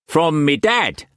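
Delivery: high, loud Cockney pirate-trader voice; Cockney swagger, pitched well up and pushed; harsh Cockney accent. A narrator's reading. Wu Kwok pressing food on Struan, in harsh Cockney accent. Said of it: "the harsh Cockney accent".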